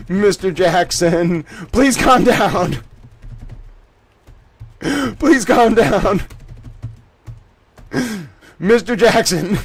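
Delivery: slow, stretched words through laughter; barely getting it out for laughing; laughing while reading. Real, not acted. Rereading the judge's rebuke, cracking up.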